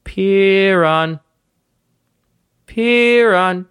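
high, long-held name call; mother's calling voice. Acted. Mysterious voice of a mom in an alley, calling. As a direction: call out slowly, stretching the name. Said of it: "you hear a voice".

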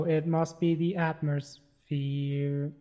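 very slow, drawn-out words; slow talking. Real, not acted. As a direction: let each word hang slowly. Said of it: "I don't know why I'm talking so slow".

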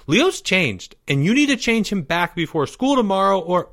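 put-on high kid voice; accuse, pitched well up; indignant young boy. A narrator's reading. Boy confronting girl at her door, high and indignant. Timmy demands of Maya.

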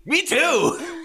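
very high-pitched yelled reply; mocking echo. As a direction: chime back at the monster in a high yell. Player shouts back at the monster.

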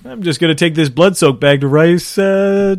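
bow out grandly in a squeaky voice, breaking into a laugh; mock polite translator. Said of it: "translator role".

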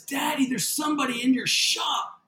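imitating alarmed daughter. Daughter spots an intruder in the shop, imitated high alarm. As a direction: imitate a child urgently alerting Dad. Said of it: "she says".

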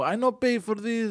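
put-on high, slow Greek accent; old Greek man. Stingy old Greek customer complaining, Greek accent. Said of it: "And they'll go".

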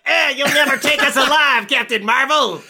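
high, loud villain yell; mock villain. Villain taunting Captain Marvel, high hammed-up yell. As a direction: cry out like a cornered bad guy. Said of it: "I don't know why he's British".

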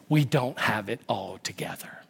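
whispered confession; admitting weakness to the church, whispered. Acted. Confession of struggle.

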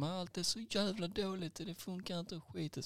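high, whining-mad customer impression; slowing, high-pitched angry grumble, hammed up; imitated ranting. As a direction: complain in a high mock rage. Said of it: "bara skriker och gapar".

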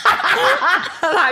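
high, slow, laughing through words; real laughter in the telling. Real, not acted. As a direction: crack up while telling.

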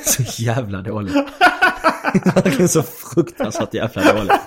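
helpless laughing through the words; real laughter. Real, not acted. Teller cracking up at his own terrible joke, real laughter. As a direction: crack up while disowning it.

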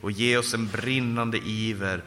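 subdued, heartfelt intercession; earnest prayer. Real, not acted. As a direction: pray aloud for the congregation, low and steady. Leads the closing prayer.

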